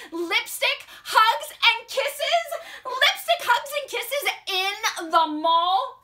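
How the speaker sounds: high, loud disbelief; appalled yell. Acted. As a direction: protest each word as if scandalised. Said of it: "yelled Andrew".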